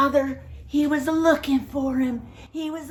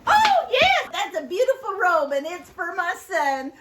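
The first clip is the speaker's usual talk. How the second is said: joyful; 6 semitones higher, 4 dB louder than usual. Acted. Joyful father over the robe.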